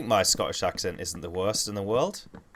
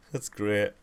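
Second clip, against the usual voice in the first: ordinary delivery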